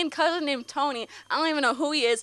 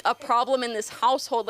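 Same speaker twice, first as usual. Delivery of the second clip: ordinary talk, nothing marked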